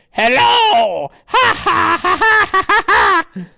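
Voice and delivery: loud, high evil cackle after a hello; booming cartoon villain hello and mock laughter; villain greeting. Two villains greet their captive's hero, cackling loudly. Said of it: "they say".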